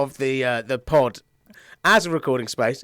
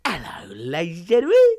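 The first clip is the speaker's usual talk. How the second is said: ordinary talk, nothing marked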